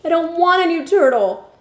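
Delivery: high, loud, near tears; a boy refusing through tears; tearful protest. Acted. Boy to the vet, tearful and loud.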